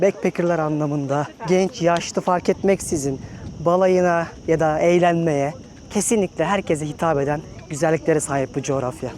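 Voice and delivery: ordinary delivery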